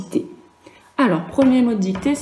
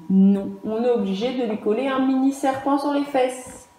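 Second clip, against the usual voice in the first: ordinary talk, nothing marked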